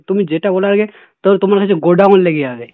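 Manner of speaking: ordinary delivery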